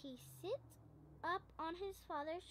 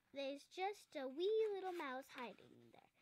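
ordinary delivery

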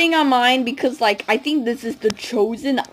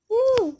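high-pitched woo; short high whoop of celebration; cheering woo; 13 semitones higher than usual. Pretend. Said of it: "Let's cheer".

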